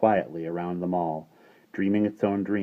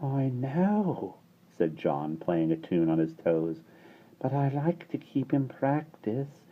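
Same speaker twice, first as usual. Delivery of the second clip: put-on higher, soft, slow little boy; baby boy voice; 5 semitones higher, 4 dB quieter, slower than usual. A narrator's reading. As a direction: mumble it like an embarrassed baby.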